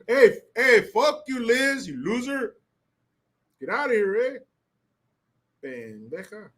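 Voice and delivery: high Chicano homie jeer. Pretend. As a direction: taunt Liz like a homie from school. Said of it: "kids who talk like that".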